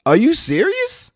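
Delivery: can't believe what just happened; storyteller reliving his shock at the rudeness; outraged disbelief. Retold or imitated. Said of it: "And I'm like".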